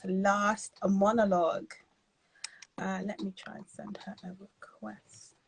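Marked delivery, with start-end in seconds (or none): 2.8-5.4 whisper to self. Real, not acted. Fiddling with app on live.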